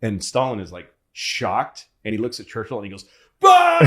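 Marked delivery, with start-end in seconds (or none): 3.4-3.8 high, shouted mock guffaw; explosive acted "bah" of a laugh; loud burst of laughter. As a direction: burst out in a huge fake laugh. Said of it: "And he just laughs".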